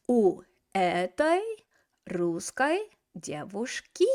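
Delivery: У этой русской девушки said slowly, each word laid out; slow model correction. Shown as a demonstration. Teacher modelling the correct genitive for learners, slow. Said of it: "Let's do it".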